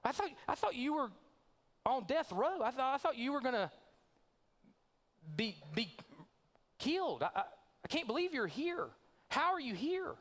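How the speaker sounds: high, astonished disbelief; shocked friend. Acted. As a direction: blurt it out like you've seen a ghost. Churchgoer shocked to see Randy.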